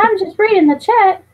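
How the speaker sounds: loud high mock excuse; too loud. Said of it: "you don't have to read it too loud".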